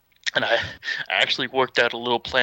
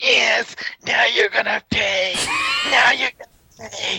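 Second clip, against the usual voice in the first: high, strained vengeful mock shout; gloating rival; 11 semitones higher, slower than usual. Storyteller acts Conrad about to punch him, frenzied gloating. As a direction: imitate a frenzied wrestler promising payback.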